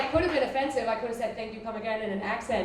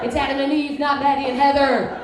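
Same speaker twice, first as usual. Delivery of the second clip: louder and louder righteous slogan; mock slogan; 3 semitones higher, 7 dB louder than usual. Comic mocking a conservative, building to loud. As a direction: build it like a protest chant.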